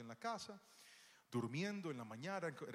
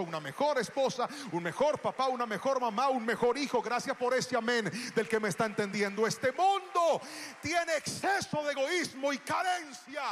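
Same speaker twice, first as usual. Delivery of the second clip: preaching at full force; 5 semitones higher, 15 dB louder than usual. Acted. Preaching climax.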